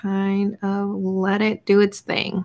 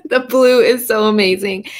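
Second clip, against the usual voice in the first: louder; 4 dB louder than usual. Real, not acted. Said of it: "I'm yelling in the microphone".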